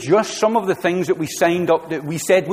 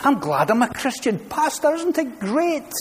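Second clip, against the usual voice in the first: much higher, bubbly mock cheer; cheery Scottish churchgoer; 9 semitones higher than usual. Preacher imitating a joyful churchgoer greeting pastor, high bubbly cheer. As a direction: chirp it up to the pastor, beaming with delight. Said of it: "talk like this".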